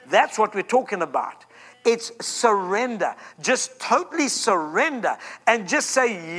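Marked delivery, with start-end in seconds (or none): none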